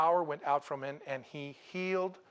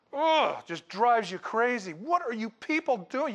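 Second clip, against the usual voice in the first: exasperated; 6 semitones higher, 4 dB louder, slower than usual. Retold or imitated. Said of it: "You just wanna scream".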